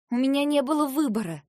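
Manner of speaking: loud, high indignant protest; getting angry. A narrator's reading. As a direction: flare up in self-defence. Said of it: "Кажется, я начала злиться".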